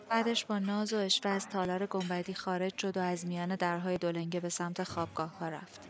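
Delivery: plain reading voice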